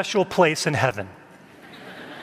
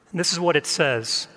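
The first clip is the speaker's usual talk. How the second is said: ordinary delivery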